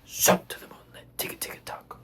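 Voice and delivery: barely audible whispered chant; pianississimo. Shown as a demonstration. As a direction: whisper as softly as possible, consonants still crisp.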